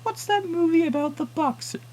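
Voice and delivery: put-on high, slow, frail old lady; old woman's voice. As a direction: ask like a confused old woman. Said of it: "the woman said".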